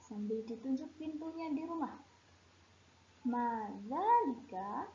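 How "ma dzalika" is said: Ma dzalika said slowly with a rising end; teacher's slow rising Ma dzalika; slow model phrase. Shown as a demonstration. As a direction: drag out Ma dzalika for the class to copy. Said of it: "Coba sekarang ikuti ustazah".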